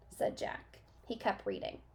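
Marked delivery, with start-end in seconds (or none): none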